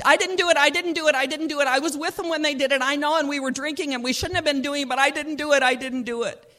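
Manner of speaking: high, loud tearful denial; crying. Acted. Markeisha crying to her friend in the car, pleading. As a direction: plead through tears, insisting. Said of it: "Markeisha started crying".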